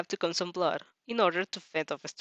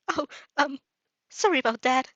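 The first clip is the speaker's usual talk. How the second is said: hasty apology; 5 semitones higher, 5 dB louder than usual. Acted. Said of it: "he hastily apologized".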